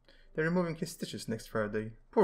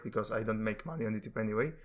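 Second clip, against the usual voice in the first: ordinary delivery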